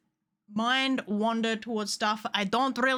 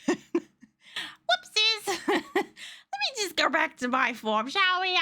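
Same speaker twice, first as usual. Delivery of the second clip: giggly whoopsies; 8 semitones higher, much slower than usual. Real, not acted. Playful apology in model.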